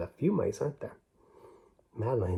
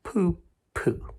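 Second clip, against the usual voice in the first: dismissive; 6 semitones higher than usual. A narrator's reading. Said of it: "Madeline just said".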